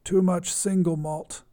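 usual speaking voice

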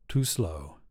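low, soft self-rebuke; low muttered thought. A narrator's reading. Ari scolding themself under breath, low and soft. Inner thought after missing bus.

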